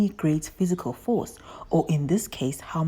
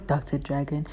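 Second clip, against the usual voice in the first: ordinary talk, nothing marked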